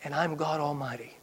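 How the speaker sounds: preacher quietly voicing God; hushed divine declaration. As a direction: let it drop to a quiet assurance. God's declaration repeated softly.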